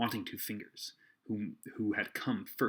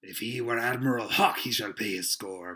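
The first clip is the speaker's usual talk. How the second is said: raised, rolling seaman's voice; cried; 6 dB louder than usual. A narrator's reading. Silver vows the runaway will pay, crying out. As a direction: declare it loudly, easing off at the end. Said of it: "cried Silver".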